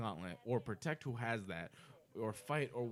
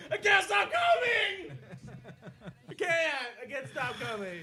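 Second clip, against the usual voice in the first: much higher, louder, slow shouted quote; Grandma's Boy quote; 13 semitones higher, 5 dB louder than usual. Retold or imitated. Quoting a comedy movie to his friend, high slow shout. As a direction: yell it like the movie character. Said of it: "like that guy from Grandma's Boy".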